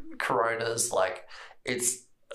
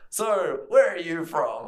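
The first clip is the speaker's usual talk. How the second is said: higher, louder chummy question; mock attendant voice; 5 semitones higher, 5 dB louder, slower than usual. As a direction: keep the pushy friendliness going. Attendant small talk.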